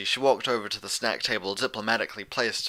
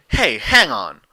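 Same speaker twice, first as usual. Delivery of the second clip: sharp interruption; 10 dB louder, slower than usual. A narrator's reading. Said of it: "interrupted with a hiss".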